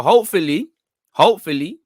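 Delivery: high, loud hopeful rise; excited hope. Real, not acted. Streamer answering chat, excited and hopeful. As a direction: sing out the hope.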